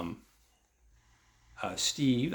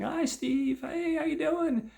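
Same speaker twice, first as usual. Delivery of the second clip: put-on much higher, sing-song girly voice; 3 dB louder, slower than usual. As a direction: do the passing girls cooing at Steve. Passing girls greet Steve.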